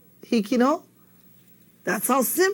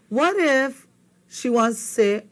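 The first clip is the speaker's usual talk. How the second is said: ordinary talk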